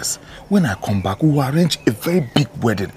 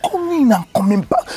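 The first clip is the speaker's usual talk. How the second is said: much higher, urgent reassurance; high pleading; 4 dB louder than usual. Acted. To his fiancée who fears he will leave, high pleading. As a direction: plead high and fast to calm her.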